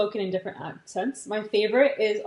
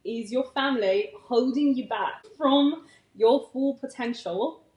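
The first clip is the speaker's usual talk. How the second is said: slow, drawn-out mock British accent; slower than usual. Shown as a demonstration. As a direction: read a title slowly in put-on British. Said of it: "title of this episode in a British accent".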